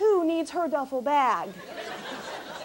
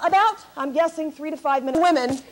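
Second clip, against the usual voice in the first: ordinary talk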